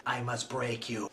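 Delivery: very low, soft menacing voice; Drago line parody. Retold or imitated. Rival fighter's owner threatening, deep and hushed. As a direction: drop deep and quiet, deadpan threat.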